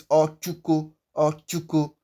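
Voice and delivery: "ochuko" with a clear stopped ch. Shown as a demonstration. Teacher repeating the correct name, ch in ochuko. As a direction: repeat "ochuko" evenly, ch not sh. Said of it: "It is ochuko".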